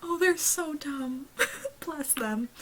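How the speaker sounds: slow teary fond sigh; laughing through tears; tearful. Real, not acted. Fond of the characters, tearful.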